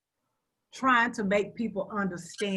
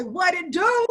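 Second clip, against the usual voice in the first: imitating a slang greeting; 9 semitones higher, 6 dB louder, slower than usual. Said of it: "Somebody walked up and say".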